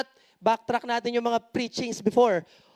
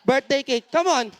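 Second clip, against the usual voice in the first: usual speaking voice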